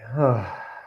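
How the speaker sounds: long audible exhale through the mouth; demonstrated sigh. Shown as a demonstration. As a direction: breathe out with a letting-go sigh. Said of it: "let go with a sigh".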